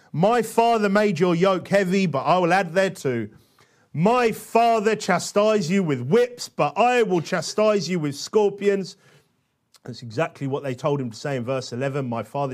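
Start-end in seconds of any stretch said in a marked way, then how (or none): 0.1-3.3 weighty reading, key words punched; emphatic scripture reading. Acted. 3.9-9.0 slow, raised, forceful declamation; ringing threat read out boldly; emphatic scripture reading. Acted. Reading the king's verbatim answer aloud.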